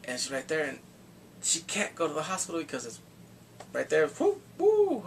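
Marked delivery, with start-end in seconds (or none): 4.1-5.0 high whoops; a quick high whoop. Real, not acted. Cheering the kidnapping threat, whooping.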